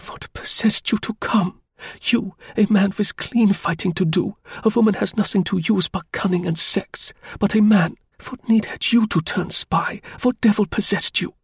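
woman wailing at the spy for coming; almost a wail. A narrator's reading. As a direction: she cries out at him, torn and despairing. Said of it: "was almost a wail".

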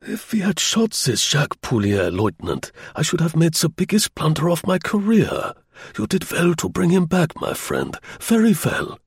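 put-on high German-accented voice, full of relief; relieved, approving German officer; relieved general. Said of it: "his tone was vibrant with relief".

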